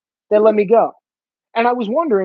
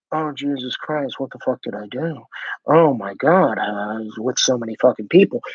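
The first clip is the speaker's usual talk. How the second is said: mock regret; 8 semitones lower than usual. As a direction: play a woman hit by dread at thirty. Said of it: "they're like".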